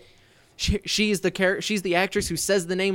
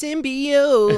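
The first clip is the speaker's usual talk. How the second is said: high, drawn-out crazed wail of a word; hammed-up unhinged cry; going insane; 9 semitones higher, 4 dB louder than usual. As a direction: lose your mind on the word, pitched high. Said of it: "she goes insane, and at one point".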